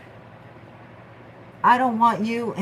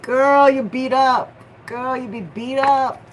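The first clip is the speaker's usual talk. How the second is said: louder, higher sassy voicing; voicing the tired person; 5 semitones higher, 6 dB louder than usual. Reader voices the client saying she's beat up. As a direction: act out the worn-out one. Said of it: "I'm so effing beat up".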